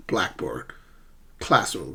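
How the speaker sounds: "Blackboard. Classroom." falling away after each first syllable; first-syllable stress. Shown as a demonstration. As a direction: compound nouns stressed on the front half. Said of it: "Always stress the first syllable".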